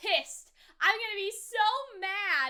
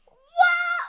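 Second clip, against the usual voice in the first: loud, high cry of amazement; amazed; 8 semitones higher, 9 dB louder than usual. Real, not acted. Viewer stunned by the reveal, amazed shout. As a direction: shout it in astonishment.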